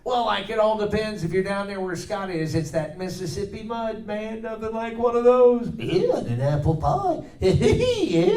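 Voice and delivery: high, excitable slacker voice rising to a giggle; high goofy cartoon voice, ending in a giggle; goofy cartoon sidekick. Answers in cartoon character voice.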